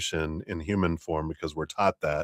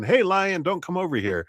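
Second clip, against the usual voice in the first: mock calling out; 12 semitones higher than usual. Calling to a lion.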